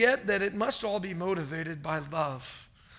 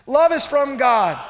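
loud, higher, drawn-out declaration; with conviction; 4 semitones higher, 9 dB louder, slower than usual. Shown as a demonstration. Preacher prompting the congregation to repeat it, with conviction. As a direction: proclaim it slowly and forcefully. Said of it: "say it with some conviction".

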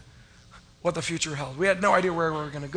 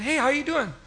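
higher, bright, friendly hello from the famous pastor; Jack's greeting; 7 semitones higher than usual. Retold or imitated. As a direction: greet him like a hearty old pastor. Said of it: "he said".

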